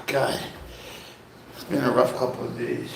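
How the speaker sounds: ordinary talk, nothing marked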